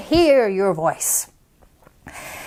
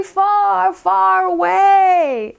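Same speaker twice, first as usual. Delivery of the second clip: carrying voice; 6 semitones higher, much slower than usual. Shown as a demonstration. Demonstrating a voice that carries far.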